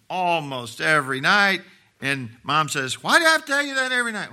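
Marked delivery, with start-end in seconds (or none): 3.0-4.3 high, loud, rapid-fire nagging; exasperated mom. Retold or imitated. Preacher playing mom nagging about nightly tooth brushing, exasperated. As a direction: imitate a mother at the end of her patience, fast and high. Mom scolding child at bedtime, voiced.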